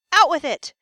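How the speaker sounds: king losing patience, shouting; impatient shout. Acted. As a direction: bark it at the stammering guard. Notice how Creon's getting upset.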